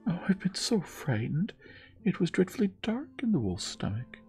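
put-on very high, breathless child's relief; frightened girl exclaiming. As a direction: tumble out of the wolf gasping with fright.

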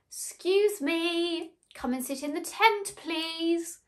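high, drawn-out mock official lady; imitated calling out. Tent lady summoning a runaway, imitated high call. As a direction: call her back in a put-on high voice.

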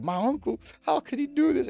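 high, shocked disbelief. Retold or imitated. Client voiced freaking out.

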